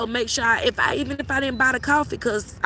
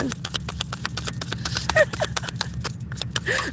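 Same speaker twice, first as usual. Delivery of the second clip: breathy laugh; 11 semitones higher, 7 dB quieter than usual. Real, not acted. Laugh after remark.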